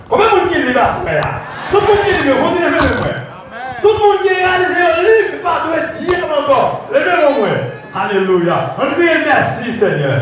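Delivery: high, forceful church shout; rallying preacher. Real, not acted. Preacher asking who is free, high rousing shout. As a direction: whip up the crowd, calling for a response. Rallies congregation at full force.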